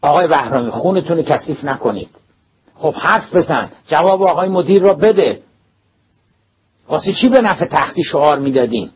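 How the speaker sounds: put-on calm the principal, then press the boy; firm teacher. A narrator's reading.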